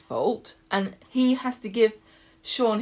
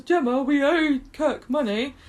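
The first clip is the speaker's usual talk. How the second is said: lightly acted, raised-pitch quote; retold soap character; 6 semitones higher than usual. Chesney telling his partner about a debt, lightly acted. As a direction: voice Chesney's line a little higher.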